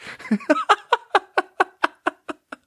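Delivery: long, high wheezy cackle; helpless laughter. Real, not acted. Picturing the coworker egging you on, high cackling. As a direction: crack up completely.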